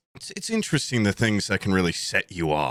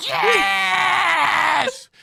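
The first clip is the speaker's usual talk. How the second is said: high, loud, drawn "Yes!"; spiteful cheer blasted into his ear; full-out cheering scream; 5 dB louder than usual. Retold or imitated. Concertgoer cheering right in the shusher's ear, screaming. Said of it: "Right in his ear".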